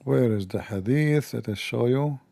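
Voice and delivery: ordinary delivery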